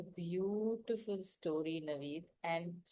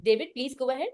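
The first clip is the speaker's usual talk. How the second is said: louder call with a rising end; 4 semitones higher, 7 dB louder, faster than usual. Real, not acted. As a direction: call his name again over the line. Host calling again, no reply.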